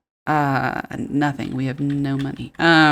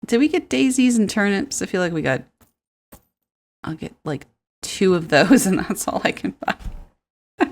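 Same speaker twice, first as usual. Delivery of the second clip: ordinary talk, nothing marked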